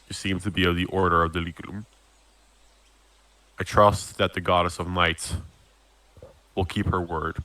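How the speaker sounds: lightly voiced low, measured captain; solemn captain. Captain addresses Glint about the goddess's word, lightly voiced solemnity.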